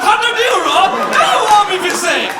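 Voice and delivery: high, loud, laughing Jamaican patois; hammed-up Jamaican patois, greedy and thrilled; suddenly eager Jamaican patois. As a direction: jump at the money in a mock Jamaican voice. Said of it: "started speaking patois".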